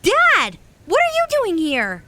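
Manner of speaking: high, loud startled call; shocked to see him there; surprised cry. Acted. Boy suddenly meeting his father in the forest, surprised cry.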